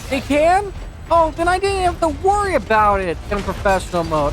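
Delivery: astonished at the news, voice jumping up; surprised realization. Real, not acted. Learns armored Ashley can't die.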